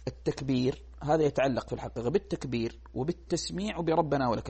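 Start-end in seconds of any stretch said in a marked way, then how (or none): none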